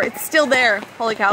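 ordinary delivery

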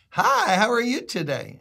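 bright, high friendly hello; cheery stranger's greeting. Retold or imitated. Woman at the store door greeting a stranger, lightly voiced cheery. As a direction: chirp it like a friendly Southern shopper.

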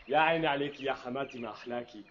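soft mock praise. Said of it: "repeats phrase differently".

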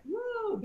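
high, soft "woo"; small high-pitched whoop of shock; mock woo. Director mimicking reaction to the hard slap, mock woo.

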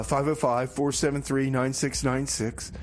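ordinary talk, nothing marked

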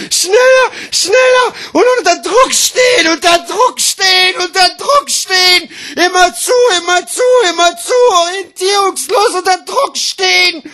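very high, loud, pressured yelling; frantic shouting. Acted. Slam poet performing being under pressure, frantic shout.

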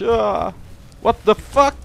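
high, loud shocked yell; startled scream. Real, not acted. Trapped player yelling in startled panic. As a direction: jump and shout in panic.

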